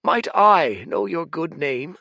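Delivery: bow with your voice, polite and proper; courtly old man. Acted. Old Fate politely introducing himself to the giant, humble courtly voice.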